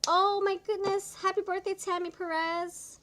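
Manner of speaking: bright, loud birthday greeting; excited greeting. Real, not acted. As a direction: cheer her birthday out loud.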